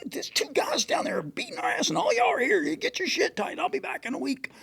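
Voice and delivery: lightly voiced owner scolding crew; chewing them out. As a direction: imitate the angry boat owner. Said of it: "He's like".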